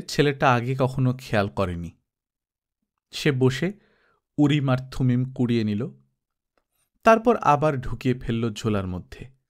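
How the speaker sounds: usual speaking voice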